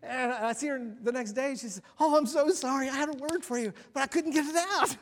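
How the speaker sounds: high, loud, laughing through it; giggling through the funny memory; laughing while telling. Real, not acted. The preacher tells the congregation about the leader's tears, laughing loudly.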